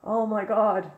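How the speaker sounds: high, startled oh my god; surprised. Real, not acted. Streamer reacts to the screen, high and surprised. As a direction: caught off guard by what he sees.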